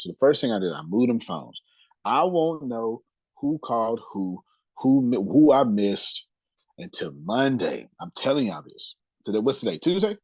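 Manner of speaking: ordinary delivery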